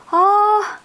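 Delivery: high, bright exclamation oh; polite surprised oh. Shown as a demonstration. Host reacting to a guest leaving, high surprised oh. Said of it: "like this".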